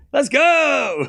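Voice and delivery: high, held-out victory cry; excited cheer. Real, not acted. Streamer celebrates a small bet win, excited cheer. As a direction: cheer it high, on the edge of laughter.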